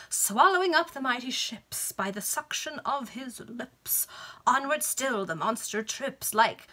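slow, sing-song recital; pompous poet reciting; recited doggerel. Acted. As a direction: declaim the bad poem proudly. Baronet recites his poem.

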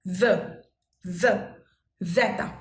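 জেতা starts on a buzzed z, not Bengali j; Italian z sound. Shown as a demonstration. Teacher correcting the Bengali j assumption, buzzed z in জেতা. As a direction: say জ and জেতা with a buzzing dz/z. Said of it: "actually উচ্চারণটা হচ্ছে".

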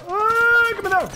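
high, loud yelp of panic; a sudden high squeal of alarm; panicked cry. Real, not acted. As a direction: shriek as the bullets land. Getting shot, panicked cry.

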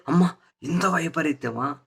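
a commentator mocking a newspaper headline, with mock dismay; mock outrage. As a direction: sarcastically ham up the paper's shock.

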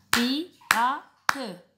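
Pirate split into three beats; clapped syllables. Shown as a demonstration. Teacher clapping out Pirate for counting. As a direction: say pi-ra-te with a clap on each beat. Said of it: "écoutez combien il y a de syllabes".

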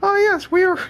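squeaky falsetto far above normal speech; strained falsetto game-character read; high-pitched voice. Acted. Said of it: "continue doing the high-pitched voice".

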